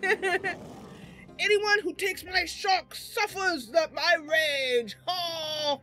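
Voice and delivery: slow, high melodramatic threat; Beast. Acted. Beast warning rivals off his shark, slow mock rage. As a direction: draw out a crazed vengeful warning. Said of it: "And Beast is like".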